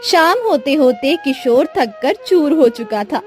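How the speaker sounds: usual speaking voice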